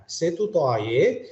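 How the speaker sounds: ordinary delivery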